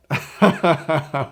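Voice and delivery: loud bright laugh before the word. Shown as a demonstration. Coach laughs out loud to show laughing with the password. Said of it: "you can laugh while you say it".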